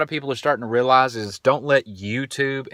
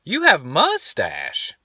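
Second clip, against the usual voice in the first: put-on slow, a bit higher, matter-of-fact child voice; imitating toddler daughter; 7 semitones higher, slower than usual. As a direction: state it slowly like a blunt toddler. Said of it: "And she just goes".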